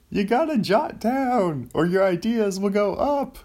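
high, loud yelling Seinfeld bit; Seinfeld impression. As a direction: whip it up high and loud like a stand-up. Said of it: "this is my Seinfeld".

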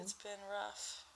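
weak, quiet sickly voice; tired and sick, slow and soft. Real, not acted. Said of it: "sorry if I sound a little sickly".

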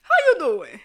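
a light Wendy Williams greeting with a falling end; greeting Andre in a light Wendy Williams voice; Wendy Williams impression. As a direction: another playful Wendy Williams try. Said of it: "put on my Wendy Williams voice".

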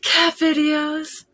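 slow, high, delighted gush. Acted. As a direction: swoon over the thought. Playful happy-thoughts list.

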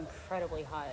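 usual speaking voice

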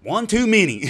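loud, high punchline burst; blurted confession. Retold or imitated. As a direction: blurt it out as the exasperated drunk. Drunk friend's punchline to the officer.

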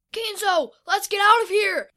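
high, loud call to flee; urgent yell. A narrator's reading. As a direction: shout to a companion to escape. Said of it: "Noah yelled".

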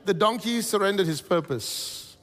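high, loud rousing sermon; preaching at full force. Acted. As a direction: lift the room with each line.

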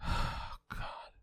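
breathy exhausted sigh into a whispered God. Real, not acted. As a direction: let it out with a tired sigh. Weary sigh after rant.

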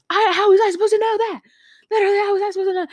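very high, loud, rushed protest; exasperated mock yell. Student protesting to the teacher, exasperated mock yell. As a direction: squawk it out in hammed-up outrage. Retold quarrel with chemistry teacher.

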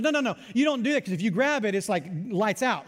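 normal talking voice

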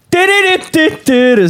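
high, drawn-out "dididi" tune; imitated intro music. Retelling the band's walk-on to friends, imitated music.

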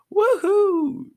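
a huge, high whoop, loud and gleeful; wild yell. Acted. Naruto whoops while chasing the cattle, high, loud yell. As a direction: let it rip like a kid chasing cattle. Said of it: "Naruto yelled".

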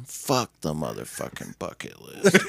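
swearing while laughing, into a high laugh; laughing while quoting a curse. Retold or imitated. Morgan swears, teller laughs.

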